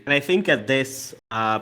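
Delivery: usual speaking voice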